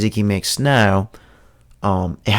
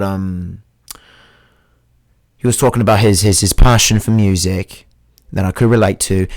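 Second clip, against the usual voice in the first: put-on a homemade mix of British, Australian and New Zealand accent held throughout; made-up British accent. Podcast host chats to his audience in a made-up British-Kiwi accent. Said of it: "I just created this accent".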